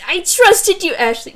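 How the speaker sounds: high, loud wounded cry; mock betrayal. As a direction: play it as a melodramatic betrayal. Character betrayed drama.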